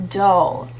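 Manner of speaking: ordinary delivery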